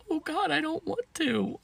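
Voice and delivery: high-pitched reluctant whine; squeamish dread. Retold or imitated. Teller dreading more gossip.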